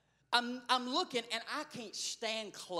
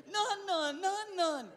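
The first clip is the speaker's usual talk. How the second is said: a lightly voiced child crying, higher; mock child scream; 8 semitones higher, slower than usual. Preacher retelling the little girl crying for her toy, mildly imitated. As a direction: imitate the toddler's wail without full volume. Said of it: "She is screaming".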